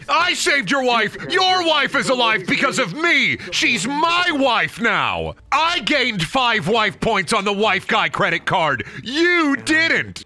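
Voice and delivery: high, drawn-out mock outrage; hammed-up indignant boast. Gamer mocks a character boasting about the wife, hammed-up indignation.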